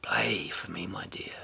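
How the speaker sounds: low, soft whisper to the harp. A narrator's reading. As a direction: hush it tenderly. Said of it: "And he whispered".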